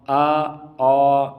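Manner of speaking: slow light آ, then heavy rounded او; thin-thick contrast. Shown as a demonstration. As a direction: open thin آ against full-mouthed او. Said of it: "دے دے کے فرق".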